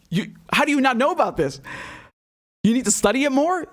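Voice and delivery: ordinary talk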